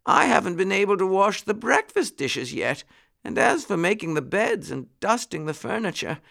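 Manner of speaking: high-pitched ailing housekeeper; muskrat lady, complaining. A narrator's reading. Muskrat lady with a headache refusing chores, complaining.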